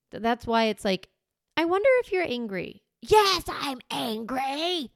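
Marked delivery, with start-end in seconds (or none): 1.6-2.8 high-pitched, careful guess about "angry"; tentative wondering. Acted. Parent guessing at an upset child's feeling, tentative. 3.0-4.9 drawn-out, high mock tantrum; angry child. Child yelling back at parent's guess, mock-angry.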